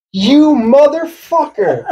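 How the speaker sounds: high, drawn-out indignant cry; retold outburst at a prankster; acted outrage. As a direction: stretch it out slowly in mock fury. Acts out friend's angry outburst.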